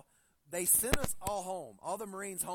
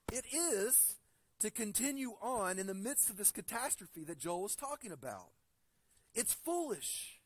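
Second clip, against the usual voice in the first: fervent preaching; 3 semitones higher than usual. Acted. Pastor urging the congregation that everyone must wake up, preaching fervently. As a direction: build it like a preacher calling the flock to wake up. Preacher builds to urgent call.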